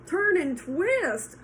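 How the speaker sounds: slow, loud, high honk; goose honking. Acted. Goose honking instructions, slow and loud. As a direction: honk it out slowly. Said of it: "honked the goose".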